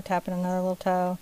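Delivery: normal talking voice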